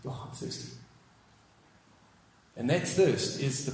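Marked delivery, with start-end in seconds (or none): 0.1-0.7 barely audible, drained whisper; weak whispered plea; faint whisper. Retold or imitated. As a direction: breathe it out with the last of his strength. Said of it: "Jesus says".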